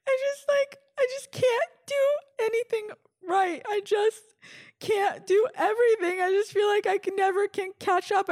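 very high, whiny sobbing voice; exaggerated tearful meltdown, squeaky and self-pitying; mock crying. Crying on the phone to her friend, hammed-up sobbing. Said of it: "I would just start crying".

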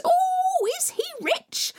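very high, squealing eager voice; greedy stepsisters. Acted.

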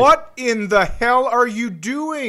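high, loud, disbelieving shout; shocked outburst. Real, not acted. Friend hearing a stolen Social Security number, shocked shout. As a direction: blurt it out in real shock.

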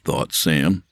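ordinary delivery

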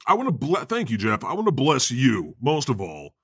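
usual speaking voice

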